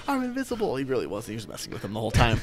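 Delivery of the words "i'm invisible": imitating very high-pitched amazement; amazed discovery. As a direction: marvel at yourself in a much higher voice. Retold hero believes he is invisible.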